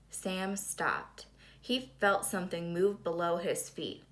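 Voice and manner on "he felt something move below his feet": ordinary delivery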